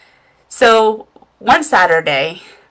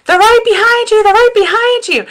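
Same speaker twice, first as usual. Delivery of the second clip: high, loud, far-off mocking yell; high-pitched teasing warning yell; mom yelling; 5 dB louder than usual. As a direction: shout it across the yard like a laughing mom. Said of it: "She's yelling at me".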